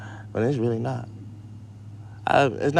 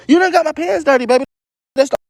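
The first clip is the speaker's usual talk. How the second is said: much higher, mock-scolding the dog; high squeaky scold at the dog; high pet-talk voice; 14 semitones higher, 6 dB louder than usual. Real, not acted.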